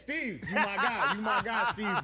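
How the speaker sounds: chuckling out "you my guy" with affection; laughing. Real, not acted. Ribbing co-host Steve, laughing loudly.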